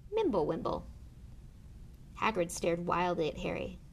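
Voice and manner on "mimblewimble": ordinary delivery, nothing marked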